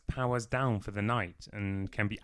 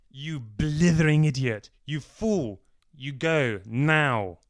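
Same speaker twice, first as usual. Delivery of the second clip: loud, higher angry shout; furious outburst; 5 semitones higher, 8 dB louder, slower than usual. A narrator's reading. Alfred shouting at Martin to go now, furious. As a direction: blast him out of the room.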